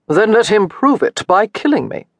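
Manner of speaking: nonchalant duelist's dare, lightly voiced; careless. A narrator's reading. Duelist answers his friend's advice before the duel, careless. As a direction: toss it off with careless indifference. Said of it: "the duelist said carelessly".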